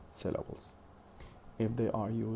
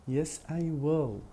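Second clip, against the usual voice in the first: slow, higher and louder, "will" complete; full stressed "will"; 6 semitones higher, 6 dB louder than usual. Shown as a demonstration. Teacher modelling a short answer for learners, full stressed "will". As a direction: land firmly on "will" at the end. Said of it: "pronounce the word in full".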